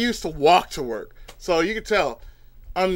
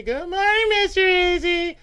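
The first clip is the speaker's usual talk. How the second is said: chorus greeting; 11 semitones higher than usual. Retold or imitated. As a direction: sing it like the whole office greeting him. Coworkers greeting him.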